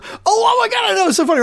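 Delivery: can barely contain the excitement, half shouting; getting all excited. Real, not acted. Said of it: "I like how you're getting all excited".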